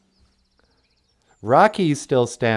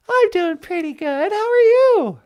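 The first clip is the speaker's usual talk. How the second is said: much higher playful lady voice; high female voice; 4 dB louder than usual. Pretend. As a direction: play the sweet girlfriend calling in, high. Natasha voice.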